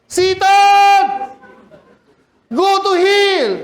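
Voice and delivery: high, loud rebuke yell; pastor shouting. Retold or imitated. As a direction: banish Satan with a roar. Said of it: "Kasi si pastor lang". (sigaw ng pastor, mataas at malakas na pagpapalayas kay Satanas)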